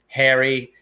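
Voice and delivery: usual speaking voice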